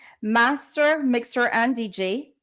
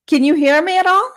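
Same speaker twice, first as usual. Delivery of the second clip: louder and higher, straining to be heard over a bad line; calling out; 5 semitones higher, 7 dB louder than usual. Real, not acted. Host calling to a guest on a faint phone line, raised loud voice. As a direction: project it so the guest on the phone can hear you.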